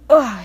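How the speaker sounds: loud shuddering ugh in the cold rain; shivering ugh. A narrator's reading. Percy shivering in the pouring rain, shuddering ugh. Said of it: "he shivered".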